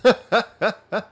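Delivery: loud, high burst of laughter. Real, not acted. Laughs after teasing a viewer.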